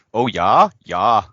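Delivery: loud, hammed-up Fargo "oh yah"; Fargo accent. Co-host demonstrating the accent he pictures, Fargo accent. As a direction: put on a broad Minnesota accent from Fargo. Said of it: "that accent from Fargo".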